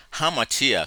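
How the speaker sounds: "Hamartia" said slowly as ha-MAR-ti-a, every syllable clear; Greek word demo. Shown as a demonstration. Teacher models the Greek word for the class, slow and clear. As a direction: say "Hamartia" carefully for students learning Greek. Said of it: "This word is hamartia".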